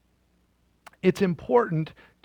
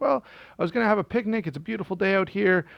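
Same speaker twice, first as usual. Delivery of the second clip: put-on higher, fast girlish chatter; light, quick Goldilocks voice; chirpy Goldilocks; 6 semitones higher, faster than usual. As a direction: rattle it off like a cheerful girl.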